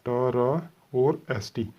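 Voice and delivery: very slow, each part held apart; syllable by syllable. Shown as a demonstration. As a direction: read पा, रा, सा out like a teacher. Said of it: "on the line बनाएंगे". (धीरे-धीरे पढ़ना)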